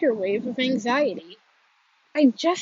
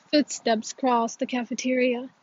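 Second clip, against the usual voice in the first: usual speaking voice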